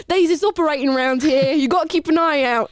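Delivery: hammed-up British accent. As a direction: put on a jokey British street warning. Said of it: "what a British thing".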